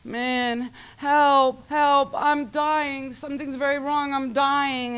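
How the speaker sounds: high, frightened little-brother wail; panicked cry for help. Retold or imitated. Little brother crying out to his big sister, high panicked wail. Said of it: "He's crying out".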